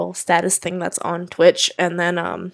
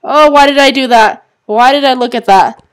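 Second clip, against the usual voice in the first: loud wail of regret; alarmed, loud self-reproach; panicked regret; 4 semitones higher, 8 dB louder than usual. Real, not acted. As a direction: cry out, louder and higher, kicking yourself. Regrets triggering the scare.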